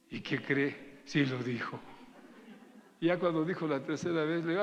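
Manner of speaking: ordinary delivery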